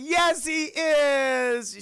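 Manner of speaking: high, loud drawn-out bawl; hammed-up crying child arguing back; wailing insistence. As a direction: stretch the last word into a wail. Little brother insists, wailing.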